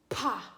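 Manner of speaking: "pa" with a puff of air on the p; P sound demo. Shown as a demonstration. Teacher modelling the sound for a child to copy, P sound demo. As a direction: lips pop open on a breathy "pa". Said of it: "say this sound".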